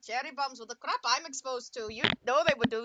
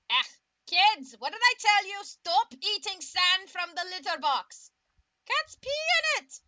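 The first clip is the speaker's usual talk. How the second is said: loud, high, slow parental scolding; scolding TF2 dad; 6 semitones higher, 7 dB louder, slower than usual. Pretend. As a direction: scold the kids like a fed-up dad. Voicing a TF2 dad scolding kids.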